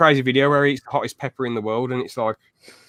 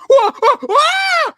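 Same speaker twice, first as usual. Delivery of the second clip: very high, loud drawn-out wails; mock wailing yells; 8 dB louder, much slower than usual. Fan imitating YouTuber on the stairs, loud mock wailing. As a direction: yelp out slow, high mock cries.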